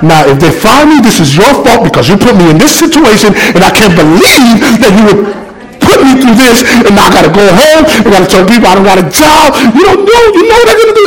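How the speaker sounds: mock-indignant rant at God, rising steeply at the end; arguing with God. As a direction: pitch climbing as the grievance builds to a high protest. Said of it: "I begin to argue with God".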